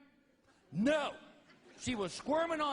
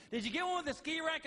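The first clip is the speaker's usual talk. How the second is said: nagging wife; 9 semitones higher than usual. Retold or imitated. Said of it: "She goes".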